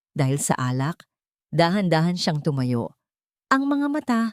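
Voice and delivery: ordinary delivery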